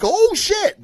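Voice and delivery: high-pitched "Oh, shit" with a jolt; shocked oh shit. Retold or imitated. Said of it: "I was like".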